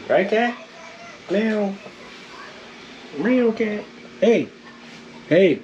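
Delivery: high sing-song voice for the cat; high pet-talk voice. Real, not acted. Talking to the cat, high pet-talk voice.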